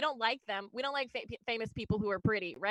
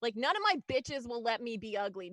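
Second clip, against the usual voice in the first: ordinary talk, nothing marked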